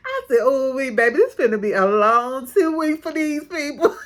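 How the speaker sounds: laughing through the words; amused disbelief, giggling; laughing while calling it a long two weeks. Real, not acted. Laughing at the couple's future.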